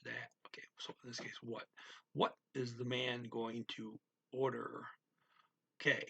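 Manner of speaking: ordinary talk, nothing marked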